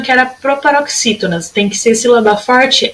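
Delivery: usual speaking voice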